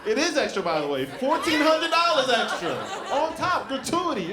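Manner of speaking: high, loud, laughing outrage; laughing rant. Real, not acted. Comic rants to audience about epidural cost, high laughing outrage. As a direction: rant about the price, cracking up.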